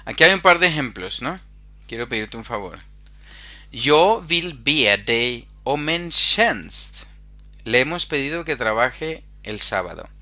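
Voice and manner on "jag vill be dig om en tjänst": slow, careful Swedish for the class; slow model reading. Shown as a demonstration. Teacher to Spanish-speaking students, slow model Swedish. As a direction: read it slowly so students can follow. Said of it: "Swedish teacher modelling sentence".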